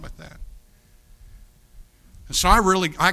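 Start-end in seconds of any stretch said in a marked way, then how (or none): 2.3-3.1 loud, rising burst of anger; angry. Retold or imitated. Preacher retelling his anger, loud burst. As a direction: let the anger break out.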